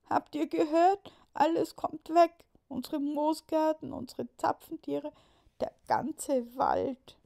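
put-on high, loud lament of small girls; upset little girls. Girls running to the boys, high upset lament. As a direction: cry out the loss to the boys.

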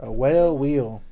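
"whale" slow and loud, falling; stress on whale. Shown as a demonstration. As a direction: correct "well" by leaning on "whale". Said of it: "A well?".

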